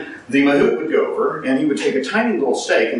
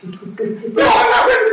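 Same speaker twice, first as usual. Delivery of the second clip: panicked cry; slower than usual. Retold or imitated. On the zipline, crying out in panic. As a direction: scream it out in sheer terror.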